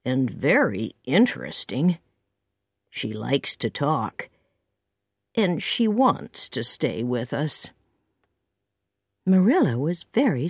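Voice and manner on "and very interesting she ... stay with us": slow, low, hesitant man; unhurried low-pitched voice; slowly. A narrator's reading. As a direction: make the case gently, taking your time. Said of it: "said Matthew slowly".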